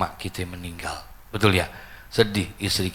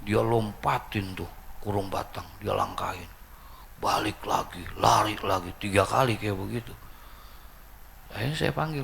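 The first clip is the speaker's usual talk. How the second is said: ordinary talk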